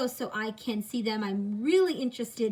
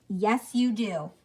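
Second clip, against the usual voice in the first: ordinary talk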